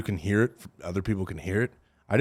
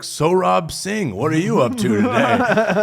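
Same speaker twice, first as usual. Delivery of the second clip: high, slow put-on Indian accent; mock Indian accent; 8 semitones higher, 4 dB louder, much slower than usual. Prankster answering a phone caller, in a mock Indian accent. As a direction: flip it on them with a hammed-up Indian accent. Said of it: "starts talking in Indian accent".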